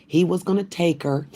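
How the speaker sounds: ordinary delivery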